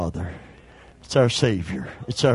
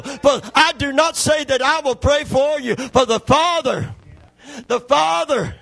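loud, higher shouted preaching climax; preaching at full force; 5 semitones higher, 3 dB louder than usual. Acted. Preacher urging the congregation to call on God, shouting at full force. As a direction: build to a shouting revival peak.

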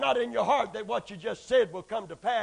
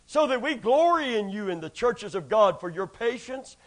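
preached scripture reading; 10 dB louder than usual. Acted.

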